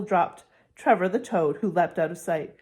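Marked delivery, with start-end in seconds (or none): none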